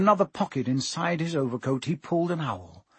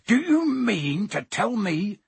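slow, rising accusation; angry growl; 6 semitones higher, slower than usual. A narrator's reading. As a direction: growl it out word by word. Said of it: "he growled at the Dursleys".

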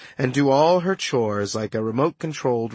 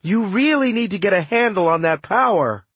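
raised, high shout; yelling over wind; 7 semitones higher, 3 dB louder than usual. A narrator's reading. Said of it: "I yelled over the wind".

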